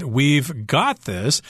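"got" drawn out with firm emphasis; quoted reassurance, weight landing on "got"; stress on GOT. Retold or imitated. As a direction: lean slowly on the word "got". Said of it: "She stressed the word got".

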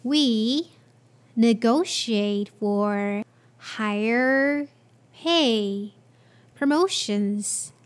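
slow, phrase-by-phrase repeat with pauses. Shown as a demonstration. Teacher repeating the sentence slowly for learners. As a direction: pause between each chunk for learners. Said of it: "I'll repeat the sentence again".

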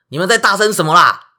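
high, loud furious shout; angry yell. Acted. Chiron bursting out at the racket, angry yell. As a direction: yell out the door at the noise. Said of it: "然后大喊".

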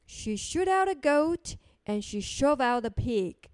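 ordinary delivery, nothing marked

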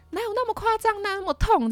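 high, dismissive imitation of the family; mocking family member. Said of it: "然后我家人就说". (模仿家人不以为然的高声调)

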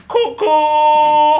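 a loud, high cutesy "哭哭"; loud whimper-word. Acted. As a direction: belt out "哭哭" like a fake cry. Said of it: "好大声". (大声撒娇地喊"哭哭")

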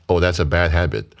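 ordinary delivery

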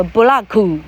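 布拉库 in three syllables, bu-ra-ku, Japanese style; 布拉库 with a Japanese accent; Japanese-style English. Shown as a demonstration. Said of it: "总之就是日本发音".

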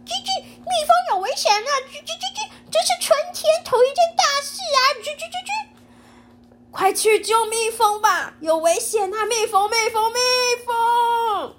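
very high, fast twittering bird; chirping bird voice. Acted. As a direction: chatter like an alarmed little bird. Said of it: "小鸟叽叽喳喳地说".

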